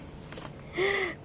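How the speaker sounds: high gasp of surprise; sharp intake of delighted breath; excited gasp. Real, not acted. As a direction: gasp at a lucky find. Thrilled by a new card.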